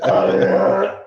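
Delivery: loud, high mock scream; imitated scream. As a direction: yell out like the creature hit. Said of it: "And, he's like".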